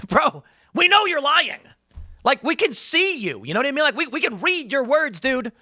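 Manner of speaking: high, incredulous calling-out; exasperated disbelief. Real, not acted. Host calling out hypocrites, exasperated. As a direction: plead high-pitched, as if it's obvious.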